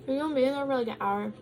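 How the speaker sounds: talking while chewing; words round a bite of food; mouth full. Real, not acted. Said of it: "Sorry, I'm talking with my mouth open".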